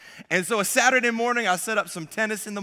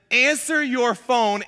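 slow, higher-pitched mock urgency; mayday demand; 4 semitones higher, 3 dB louder, slower than usual. Reading out wife's mayday text, urgent demand. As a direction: spell out an urgent demand word by word. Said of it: "I got a Mayday text message".

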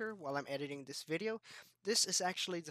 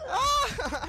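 high, loud "ah" cry; sudden high-pitched outcry; startled yell; 10 dB louder than usual. Real, not acted. Player caught out in a firefight, startled loud yell.

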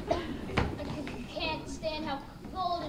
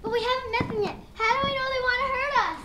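loud; 6 semitones higher, 7 dB louder than usual. Acted. Settler objects to attacking natives.

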